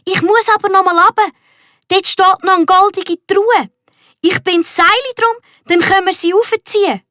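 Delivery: high, fast, excited rush; boy rushing to dive for the treasure chest; eager. A narrator's reading. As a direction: tumble out the plan in a hurry. Max eager to dive again.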